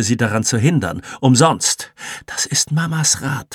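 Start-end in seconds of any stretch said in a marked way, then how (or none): none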